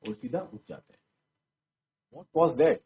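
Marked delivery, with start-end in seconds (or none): 2.1-2.8 a sudden, louder startled cry; cried out. Acted. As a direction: call out, startled by the crash. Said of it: "Hannah cried". (हैना का चौंककर ज़ोर से पुकारना)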